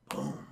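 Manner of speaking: very low, soft footstep thud; imitated distant boom. Imitating the T-Rex footsteps for chat, hushed boom. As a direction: voice a faraway thud under your breath.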